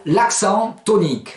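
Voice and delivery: usual speaking voice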